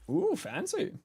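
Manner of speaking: very high, breathy ooh fancy; impressed squeal. Real, not acted. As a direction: squeal at something shiny.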